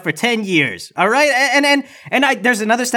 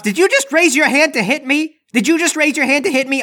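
mock outrage; 7 semitones higher than usual. Fake accusation of assault.

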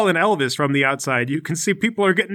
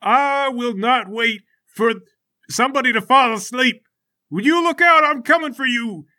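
high, stretched-out battle threat; defiant fighter; 8 semitones higher than usual. Acted. Bald fighter to Larry in the fortress, defiant threat. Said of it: "The guy goes".

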